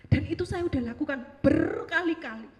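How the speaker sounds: ordinary talk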